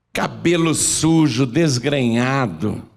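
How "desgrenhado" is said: usual speaking voice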